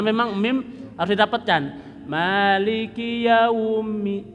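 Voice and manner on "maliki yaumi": Maliki yaumi chanted slowly, lips shut on mim; closed mim. Shown as a demonstration. Teacher models the closed mim for students. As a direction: press the lips together on mim.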